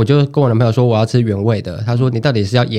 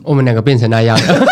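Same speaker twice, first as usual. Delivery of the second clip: ordinary talk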